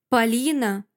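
ordinary delivery

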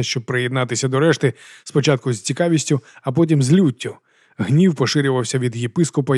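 plain reading voice

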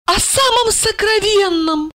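put-on high-pitched drunk woman; high, self-important drunken confidence; tipsy explaining. A narrator's reading. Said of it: "пояснила она и снова икнула".

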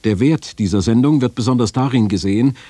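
ordinary talk, nothing marked

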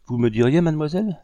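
quick, eager question rising high on mademoiselle; lively, keen surprise. A narrator's reading. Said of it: "fit vivement Delgrès".

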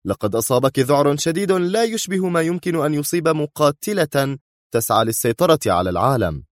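firm accusation rising in pitch; stern. A narrator's reading. Man accuses Sonia of loving her enemy, stern. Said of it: "أجاب في صرامة".

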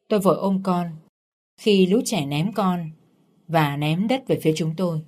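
plain reading voice

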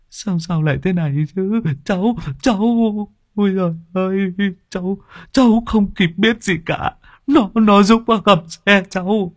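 high, stammering terrified plea; trembling fear. Acted. Driver stammers to the crowd after the accident, terrified. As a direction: stutter out the excuse in shock. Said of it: "còn đang ngồi run cầm cập".

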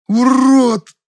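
very high, loud yelp; pained yell. A narrator's reading. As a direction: yelp out in pain. Tormentor screams in pain.

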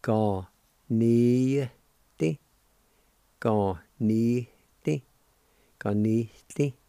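slow syllable by syllable Ga, ni, ti; Cherokee ganiti. Shown as a demonstration. Teacher sounding out Ga, ni, ti for learners. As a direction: sound out Ga ni ti, each syllable apart. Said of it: "like this".

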